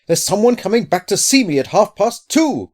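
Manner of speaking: high, loud, agitated outburst; frightened voice pitched right up; panicked cry. A narrator's reading. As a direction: cry out in dread. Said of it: "cried Loveday".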